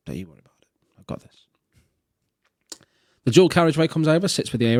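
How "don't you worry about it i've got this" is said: low, whispered aside; whispered, confident barman; hushed reassurance. Acted. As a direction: murmur it very softly under your breath. Barman's hushed reply in a joke.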